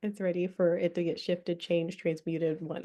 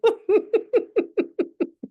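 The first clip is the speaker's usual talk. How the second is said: high giggling laughter; 5 dB louder than usual. Real, not acted. Keeps laughing after "that simple.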